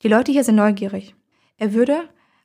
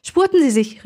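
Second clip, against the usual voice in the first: mild narrated call, pitched higher; 5 semitones higher than usual. A narrator's reading. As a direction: call it out urgently, but contained. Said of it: "rief ich".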